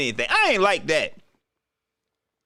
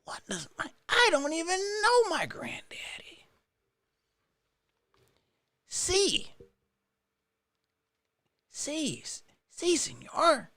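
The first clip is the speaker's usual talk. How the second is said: high, whispered fake sob; mock crying; 9 semitones higher, 6 dB quieter than usual. [name]'s voice pretends to weep over his granddaddy, whispered. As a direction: whimper it like a hurt kid, barely voiced.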